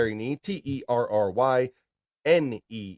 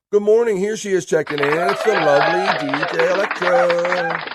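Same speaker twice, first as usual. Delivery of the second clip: announcer intro; 7 semitones higher, 7 dB louder than usual. Acted. Host announcing Electra's arrival, loud and high. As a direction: introduce her like a ring announcer.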